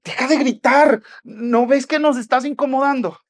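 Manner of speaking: high, loud, annoyed telling-off; acted exasperated shout; scolding rebuke. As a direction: scold the noisy man as a bothered bystander. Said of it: "lo reprendían para que se callara".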